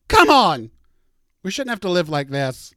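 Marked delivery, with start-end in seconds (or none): 0.1-0.7 loud, high cry of frustration; exasperated shout. Real, not acted. Complaining about the neighborhood stench, an exasperated shout. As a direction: throw it out in disbelief. Ranting about fart gas outside.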